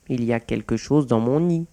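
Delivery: usual speaking voice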